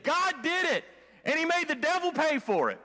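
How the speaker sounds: woman answering neighbor, triumphant and laughing; triumphant punchline. Retold or imitated. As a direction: deliver the punchline with glee.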